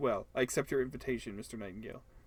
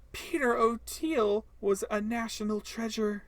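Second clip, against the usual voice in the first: slow, high, put-on announcer voice; grand pronouncement; 8 semitones higher, 4 dB louder, slower than usual. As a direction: declare it like a solemn tribute.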